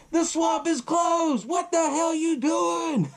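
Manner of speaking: high, drawn-out shout breaking into laughter; mock-yelling at the boat guide; slow laughing shout. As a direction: bellow the warning slowly while cracking up. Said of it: "he's telling him".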